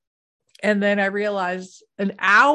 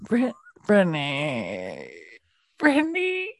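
frantic name calling; 4 semitones lower than usual. Retold or imitated. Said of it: "that's how I sounded".

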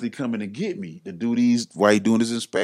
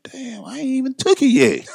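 much higher, louder alarmed quote; mock alarm; 5 dB louder than usual. Speaker reacting to Johnny's public post, high mock alarm. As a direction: sound caught off guard. His retold panic at the post.

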